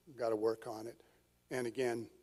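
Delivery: ordinary talk, nothing marked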